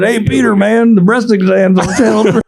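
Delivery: a hammed-up buddy; character quote. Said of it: "he said".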